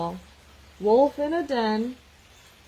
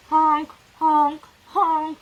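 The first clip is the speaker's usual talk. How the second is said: loud, higher honking like geese; goose honk; 8 semitones higher, 9 dB louder, much slower than usual. Acted. Reading the geese's part to children, loud honks. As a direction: honk like geese flying south.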